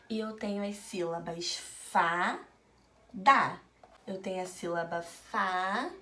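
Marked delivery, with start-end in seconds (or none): 1.6-3.6 each syllable said apart, "da" louder. Shown as a demonstration. Teacher showing children the syllables, syllable by syllable. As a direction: sound out "fa", pause, then a punched "da". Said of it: "quando eu leio de sílaba em sílaba".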